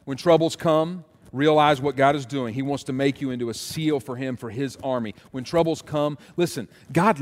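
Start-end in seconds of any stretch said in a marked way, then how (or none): none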